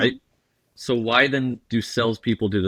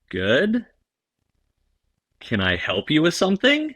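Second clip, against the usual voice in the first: puzzled, questioning upturn at each end; hesitant, rising "what do you want?" tone; wary politeness; 5 semitones higher than usual. Acted. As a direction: answer a stranger with rising suspicion. Approached stranger answers, puzzled.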